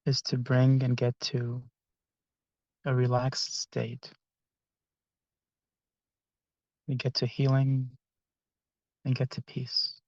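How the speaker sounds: soft, unhurried meditation voice with long pauses; guided meditation. Acted. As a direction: gently guide the group into relaxation, phrase by phrase.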